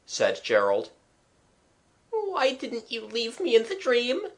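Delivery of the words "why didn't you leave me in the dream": put-on higher, plaintive boy on the edge of whimpering; near whimper. Frightened younger brother pleading with his brother in the dark, near whimper. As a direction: ask it like a scared child about to cry. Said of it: "Jimmy almost whimpered".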